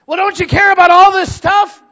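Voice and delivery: very high, very loud outburst; hammed-up frantic demand; mock panicked shout. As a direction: yell it like a stressed-out coworker. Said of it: "everybody's panicked and everybody's stressed".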